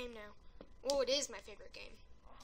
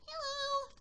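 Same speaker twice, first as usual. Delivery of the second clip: sneaky greeting; 12 semitones higher than usual. Retold or imitated.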